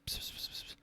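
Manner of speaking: unvoiced hushed psst sounds; mock secret whispering; whispered psst. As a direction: hiss it like a secret phone aside. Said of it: "just whispering".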